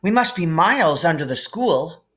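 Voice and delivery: lightly voiced girl; a hint of an awed girl; Hermione voice. A narrator's reading.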